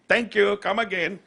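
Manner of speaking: high-pitched mock Indian accent; Apu. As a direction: imitate a cartoon shopkeeper's thick Indian accent. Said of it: "voice the thickly accented Apu".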